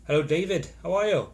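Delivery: loud, lilting mock Welsh greeting; bad Welsh accent. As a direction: call out a greeting in a badly faked Welsh accent. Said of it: "that was bad Welsh accent".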